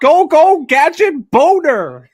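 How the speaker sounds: loud, high, drawn-out cartoon call; mock catchphrase shout. Joking with a co-host over the film, mock heroic shout. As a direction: belt it out as a joke catchphrase, slowing at the end.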